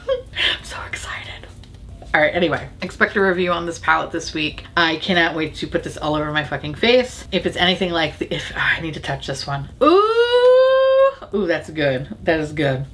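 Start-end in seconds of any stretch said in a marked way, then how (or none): 0.1-1.2 softer, whispery excited squeal; giddy excitement. Real, not acted. Gushing about hugging sloths, giddy whispered squeal. 9.8-11.1 long, high, loud "ooh"; delighted ooh. Real, not acted. Swatching a palette shade, high delighted ooh.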